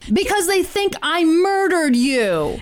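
high, slow indignant reply; exasperated prisoner. Retold or imitated. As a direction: say it in disbelief. Stephen voiced indignant.

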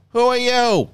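high, loud mock old lady challenge; calling out. Old lady from Rise of Skywalker, calling out. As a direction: call it out in a high put-on voice.

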